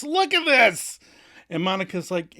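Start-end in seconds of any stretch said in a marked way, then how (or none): 0.0-1.0 high, loud, exasperated cry; furious outburst. Retold or imitated. Rachel finding another hole in the wall, as a high, loud furious outburst. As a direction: snap it out in outrage. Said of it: "Rachel furiously O M Gs and spits".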